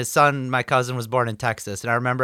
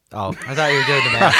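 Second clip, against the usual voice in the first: ordinary talk, nothing marked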